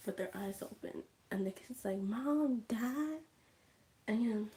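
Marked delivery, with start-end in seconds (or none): 2.0-3.2 higher, searching call; kid calling parents. Retold or imitated. Kid to parents who don't reply, calling out higher. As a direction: call them with a lifted, questioning voice.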